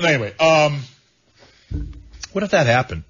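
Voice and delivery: normal talking voice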